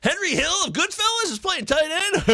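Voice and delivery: very high, squeaky disbelief; hammed-up incredulous squeal for a joke; mock astonishment. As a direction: push it into a comic falsetto question. Joke bit on a player's name.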